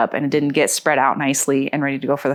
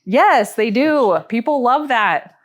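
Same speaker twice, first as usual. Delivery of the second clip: higher, eager yes; excited agreement; 6 semitones higher, much slower than usual. Real, not acted. To the host, about pets in photos, eager yes. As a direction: gush that people love pets.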